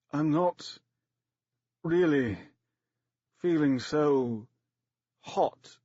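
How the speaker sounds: a lightly groaned, slow actor's voice; dying actor on the operating table, mildly groaning; deep actor's groan. A narrator's reading. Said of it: "groaning in his deep actor's voice".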